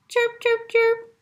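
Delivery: tiny, very high chirps; squeaking chicks. A narrator's reading. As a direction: squeak like baby birds. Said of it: "squeaked the chicks".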